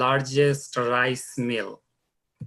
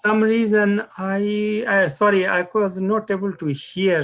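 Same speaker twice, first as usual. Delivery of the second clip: speaking up; 6 semitones higher, 5 dB louder than usual. Real, not acted. Speaking up over bad line.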